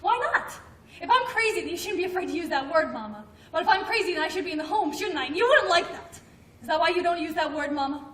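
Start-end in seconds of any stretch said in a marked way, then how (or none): 0.0-1.6 heated challenge. Acted. Daughter confronts mother heatedly. 3.5-6.2 heated challenge. Acted. Daughter confronts mother heatedly.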